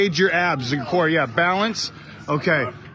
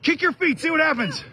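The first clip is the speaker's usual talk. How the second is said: high, amazed shouting and laughing; excited; 11 semitones higher than usual. Real, not acted. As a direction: cry out in real amazement. Amazed as the trick works.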